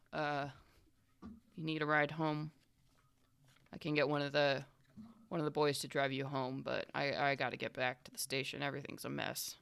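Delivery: low, subdued offer of help; a boss's quiet, halting offer; gruff-mannered police boss. Acted. Frank offers the detective a ride home, subdued.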